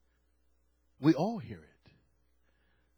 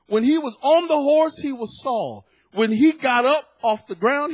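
louder, higher fervent preaching; preaching at full force; 9 semitones higher, 5 dB louder than usual. Acted. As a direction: drive it home to the church. Preaching builds.

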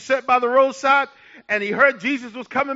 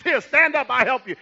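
ordinary delivery

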